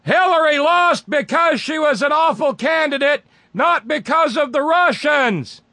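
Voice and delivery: very high shouted reading of caps; all caps shout. Retold or imitated. Host reading the email aloud as an all caps shout. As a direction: yell it like all caps text. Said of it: "All caps".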